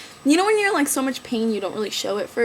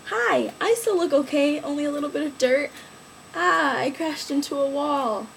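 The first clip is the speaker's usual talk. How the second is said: talking shoe voice; 4 semitones higher than usual. Pretend. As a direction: play a little shoe introducing itself. Voices her dirty shoe.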